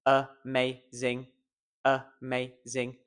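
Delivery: slow "amazing" in three even, separate parts; evenly weighted, separated syllables in "amazing". Shown as a demonstration. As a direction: split it up, a, ma, zing. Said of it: "I find the stressed syllable".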